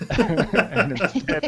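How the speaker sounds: words broken up by real laughter; laughing. Real, not acted. Player cracks up while declaring the next move, laughing. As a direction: try to carry on through a loud, high laugh.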